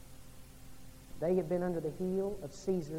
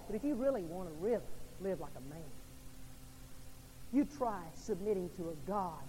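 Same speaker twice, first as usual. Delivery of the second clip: usual speaking voice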